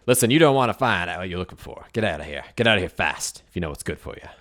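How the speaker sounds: loud, fast warning to back off; forceful, quick-fire threat; threatening tough character. Acted. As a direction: play a tough guy giving a rapid warning.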